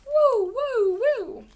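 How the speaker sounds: high, loud wailing siren; fire siren imitation. A narrator's reading. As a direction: woo like an approaching fire engine.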